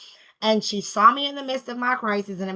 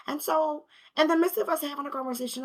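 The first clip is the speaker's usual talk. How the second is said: usual speaking voice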